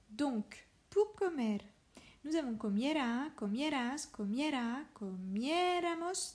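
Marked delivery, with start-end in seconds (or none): 4.9-6.3 comiéramos with a high, drawn-out É; stress on accented é. Shown as a demonstration. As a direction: lean long and high on the É of comiéramos. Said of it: "attention à l'accent".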